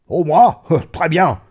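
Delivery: loud, high, self-satisfied reply; jovial boasting; boisterous old friend. Acted. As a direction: crow it with a laugh. Friend boasts of doing well.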